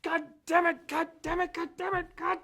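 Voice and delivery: screaming high and loud in frustration; furious yelling. Retold or imitated. Roommate retelling Roger's outburst at the game, furious yell. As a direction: bellow the curse over and over, building. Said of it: "He goes".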